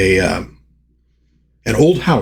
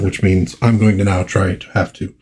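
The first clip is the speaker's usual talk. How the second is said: usual speaking voice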